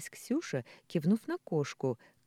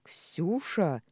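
drawn-out, reproachful exclamation; indignant boom; 3 semitones higher, 3 dB louder than usual. A narrator's reading. Father rebukes his teasing daughter, indignant boom. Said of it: "возмущённо прогудел Михаил".

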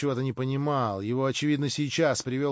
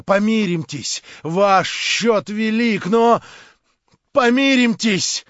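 desperate plea; 7 semitones higher, 8 dB louder, slower than usual. A narrator's reading. Desperate pleading for peace.

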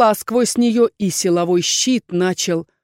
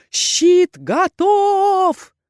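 much higher, very slow loud cry; shout; 10 semitones higher, 4 dB louder, much slower than usual. A narrator's reading. Erhan shouts to the team, loud drawn-out cry. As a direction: drag out the shout. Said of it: "Эрхан закричал".

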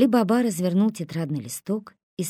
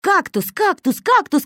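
high loud chanted taunt; shouting taunt; 13 semitones higher, 8 dB louder than usual. A narrator's reading. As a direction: yell it at him. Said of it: "кричал Косой на Али-Бабу". (кричит дразнясь)

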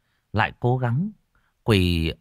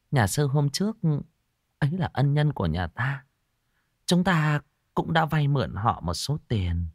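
gentle coaxing; 7 semitones higher than usual. Acted. Wife gently urging husband to repay monk, higher. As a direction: coax him gently about the debt. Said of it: "thẽ thọt".

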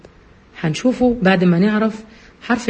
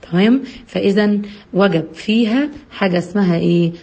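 ordinary delivery

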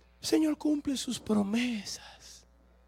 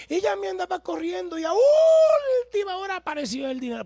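excited shout; 9 semitones higher, 8 dB louder than usual. Acted. Excited testimony climax.

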